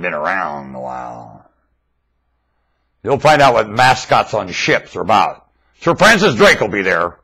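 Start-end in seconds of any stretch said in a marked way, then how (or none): none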